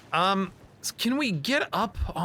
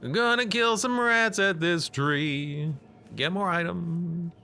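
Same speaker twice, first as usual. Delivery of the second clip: game announcer voice; 5 semitones higher, slower than usual. Pretend. Continuing announcer voice.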